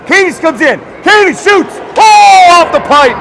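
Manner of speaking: excited play-by-play. Real, not acted. Announcer calling a shot off the pipe, excited. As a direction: call the shot with rising urgency. Live call of a near goal.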